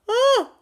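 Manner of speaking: sudden high yawn; loud yawn. Real, not acted. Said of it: "a yawn just hit me".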